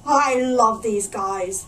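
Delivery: slow, loud pirate sentiment; pirate thinking fondly. A narrator's reading. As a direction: drag it out, fond of his rowdy crew. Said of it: "thought Backbeard".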